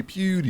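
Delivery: "Pewdie" with a clear P at the start, pitched high; corrective pronunciation. Shown as a demonstration. Correcting a friend who heard Cutie Pie, "Pewdie" with a clear P. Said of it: "No, Pew with a P".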